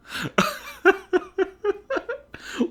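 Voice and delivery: shrill mocking cackle; high goofy laugh. A narrator's reading. Neptune grinning.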